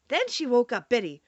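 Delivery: ordinary delivery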